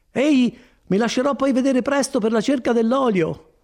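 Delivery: high, lively farewell; animated monk seeing a visitor off; obliging friar. Acted. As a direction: chat on brightly, rising to a call. Voices Fra Galdino saying goodbye.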